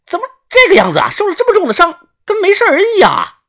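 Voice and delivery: 高声慌张惊叫，带点结巴; 吓坏了似的尖着嗓子喊. A narrator's reading. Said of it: "惊呼道". (又高又急地惊恐大喊)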